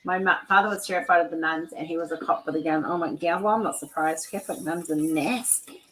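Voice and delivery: ordinary delivery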